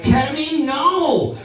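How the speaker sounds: high indignant no; hammed-up refusal when a girl offers ketamine; mock shocked refusal. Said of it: "And I was like".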